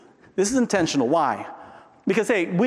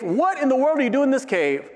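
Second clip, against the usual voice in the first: God challenging Elijah; 10 semitones higher than usual. Acted. Acts God challenging Elijah in cave.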